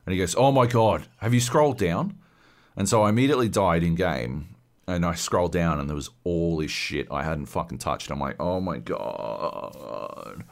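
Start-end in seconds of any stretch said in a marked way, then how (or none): 0.3-2.2 louder, raised "oh my God"; exasperated realisation. Retold or imitated. Brother realising the player never scrolled down, loud and exasperated. 8.4-10.4 low, slow, hushed disbelief; stunned dismay. Retold or imitated. Gamer seeing all the missed upgrades, slow, hushed and stunned.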